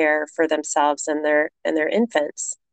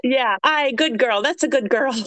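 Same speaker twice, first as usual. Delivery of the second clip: higher-pitched approving Irish voice; Irish accent; 5 semitones higher than usual. Retold or imitated. Irish cashier praising a returning customer, Irish accent. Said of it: "That's what she would say".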